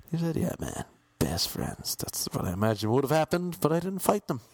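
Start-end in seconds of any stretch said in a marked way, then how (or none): 0.4-2.0 low, slow Pacino voice. Pretend. Imagined Pacino whispered reply.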